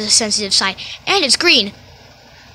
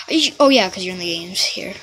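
normal talking voice

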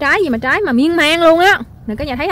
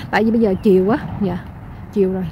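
ordinary talk

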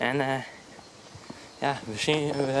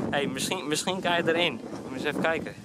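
usual speaking voice

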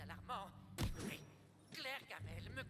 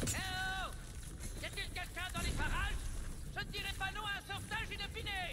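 calling out; 6 semitones higher, 8 dB louder than usual. Acted. Trapped character calling for rescue.

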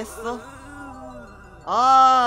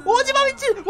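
panicked yell; 13 semitones higher, 8 dB louder than usual. Real, not acted. Chased by enemy.

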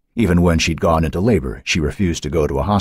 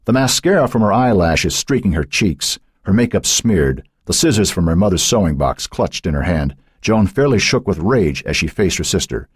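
usual speaking voice

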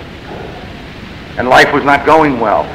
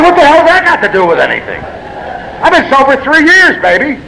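indignant retort; 10 semitones higher than usual. Retold or imitated. Retells snapping back at old-timer.

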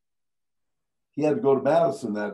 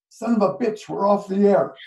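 higher, louder alarmed cursing; panicked curse; 7 semitones higher, 4 dB louder than usual. Retold or imitated. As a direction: blurt it in on-air panic. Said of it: "And he says".

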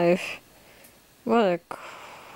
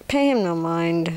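ordinary talk, nothing marked

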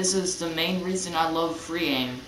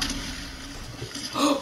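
high startled gasp; 10 semitones higher, 4 dB quieter than usual. Real, not acted. Sees explosive rounds.

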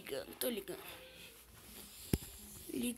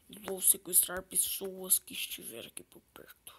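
whispering; 2 semitones lower, 9 dB quieter than usual. Pretend. Alien's secret aside.

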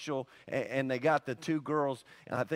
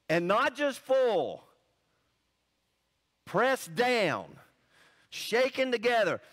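preaching scripture; 4 semitones higher, slower than usual. Acted. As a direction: proclaim each phrase to the congregation.

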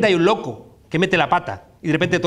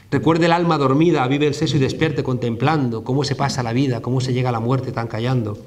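recited poem; 7 semitones lower than usual. Acted. Lecturer recites Manrique's coplas to the audience, low and solemn. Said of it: "las coplas por la muerte".

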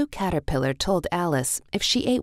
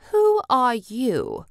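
higher, slow, imperious queen; Queen's demand; 7 semitones higher, 4 dB louder, slower than usual. A narrator's reading. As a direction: demand it haughtily from the throne. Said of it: "the Queen asked".

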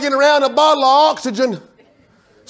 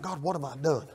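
soft, puzzled plea to God; hushed bewildered prayer; 8 semitones lower, 15 dB quieter than usual. Retold or imitated. Husband outside puzzling over his wife, hushed. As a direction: murmur it, lost and confused.

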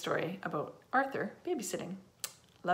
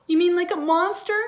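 put-on high, anxious child's voice; pitched-up, loud worried question; meek; 10 semitones higher, 11 dB louder than usual. A narrator's reading. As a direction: ask like a scared little boy. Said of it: "Tommy asked meekly".

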